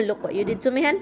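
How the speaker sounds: normal talking voice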